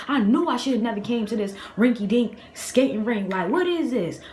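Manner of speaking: high-pitched indignant rant, acted out; retold angry yelling. Robbed skater rants at everyone in the rink, mock yelling. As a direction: re-enact cussing out the whole place. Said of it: "I'm yelling, I'm cussing".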